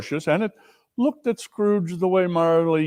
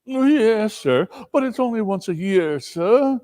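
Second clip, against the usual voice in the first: higher, meek and pleading; timid clerk; 8 semitones higher than usual. Acted. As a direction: beg your boss politely, a bit nervous.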